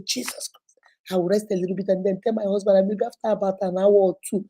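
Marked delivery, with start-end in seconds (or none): 0.1-0.8 whispered Jesus Christ, tired of the noise; mother whispering to herself while hiding from the kids; whispered exasperation. Retold or imitated. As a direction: breathe it out unvoiced, worn out. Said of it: "And I will say".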